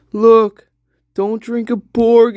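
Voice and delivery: ordinary talk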